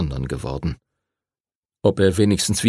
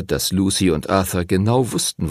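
ordinary delivery

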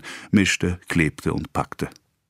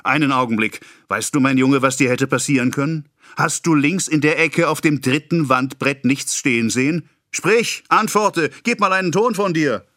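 put-on higher, demanding the boy answer; stern interrogation; 8 semitones higher than usual. A narrator's reading. Pharmacist grilling his apprentice, higher and demanding. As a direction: corner him with questions.

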